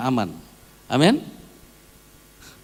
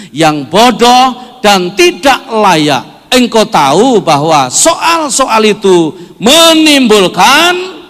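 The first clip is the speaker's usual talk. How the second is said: loud, high, drawn-out congregational reading; feierliche Schriftlesung; 10 semitones higher, 17 dB louder, slower than usual. Real, not acted. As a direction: lead everyone through the verse at full volume. Leads congregational Bible reading.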